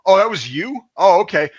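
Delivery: high, loud, taunting question; imitated sarcastic drill sergeant; mock surprise. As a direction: feign amazed discovery to needle a recruit. Another sergeant mockingly singling him out.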